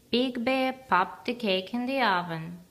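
usual speaking voice

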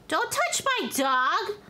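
slow, high, outraged warning; guard the dog, draw it out; protective yell. Acted. Streamer warning a sim off her dog, outraged. Protective owner yelling at stranger.

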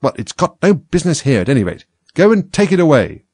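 high, loud, indignant command; cross order. A narrator's reading. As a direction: order the servant off impatiently. Rabbit orders servant to remove it.

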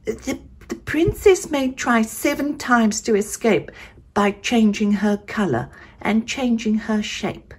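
high gentle fairy godmother voice; sing-song magical fairy spell. A narrator's reading. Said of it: "she waved her wand and said".

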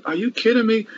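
high-pitched incredulous scoff; God scoffing. Acted. Preacher voicing the Lord's reaction to rejection, scoffing in a high pitch. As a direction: voice mock disbelief, pitched well up. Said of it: "The Lord scoffs at them".

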